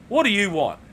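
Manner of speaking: mock-angry demand thrown at an intruder; irritated. Ranting colleague snaps at him.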